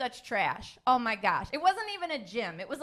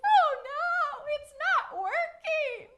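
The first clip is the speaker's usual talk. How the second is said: much higher, whiny wail; mock crying; 12 semitones higher, much slower than usual. Patient wailing to her doctor about weight gain, mock crying. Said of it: "and I'm crying".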